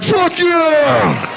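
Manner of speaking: a laughing imitated whoop; mock excited cheer. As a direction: cheer it like the mullet dude. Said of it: "He was like".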